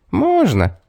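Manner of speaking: high, sure little man's reply; confident little man. A narrator's reading. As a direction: answer sure of yourself, pitched high. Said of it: "уверенно ответил человечек".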